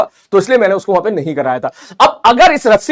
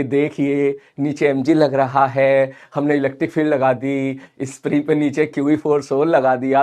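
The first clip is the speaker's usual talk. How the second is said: slow, soft, drowsy lecture voice; mock sleepy slow teacher voice; slow boring teaching; 6 semitones lower, 6 dB quieter than usual. Shown as a demonstration. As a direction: drag it out so the class nods off. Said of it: "अगर मैं ऐसा slow बोलूंगा".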